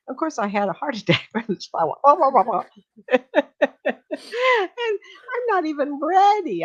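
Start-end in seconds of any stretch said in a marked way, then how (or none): 1.9-2.6 mock wailing. Mock wailing after a fright. 3.1-6.6 high-pitched laugh through the words; real laughter breaking the words. Real, not acted. Laughing at her own fright.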